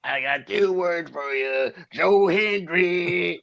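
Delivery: slow throaty impression, rising on the name; hammy, drawn-out commentator imitation; Booker T. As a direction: stretch it out and oversell the name. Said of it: "we're trying to do his voice".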